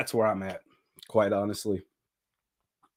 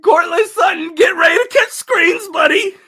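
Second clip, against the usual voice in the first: high, loud, cracking with laughter; laughing mock address; 5 dB louder than usual. Real, not acted. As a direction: shout at the receiver through laughs.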